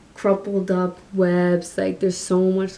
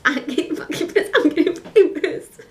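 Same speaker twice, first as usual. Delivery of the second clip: high-pitched comic fuming, breaking into laughter; mock tantrum; 8 semitones higher, 3 dB louder than usual. Vlogger fed up with the costume, hammed-up exasperation. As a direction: whine it higher and higher, half laughing.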